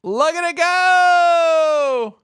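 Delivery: high, loud, slow drawn-out yell; excited shout. Real, not acted. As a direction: howl in awe as the line climbs. Price shoots off the chart.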